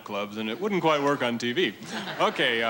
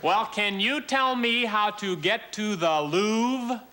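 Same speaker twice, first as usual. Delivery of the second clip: high, slow lady's voice; tourist lady; 8 semitones higher, slower than usual. Acted. American lady asking the way to the Louvre, high slow voice. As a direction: ask slowly and high, every word separate. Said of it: "it's like this lady comes in".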